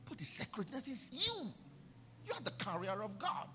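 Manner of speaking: normal talking voice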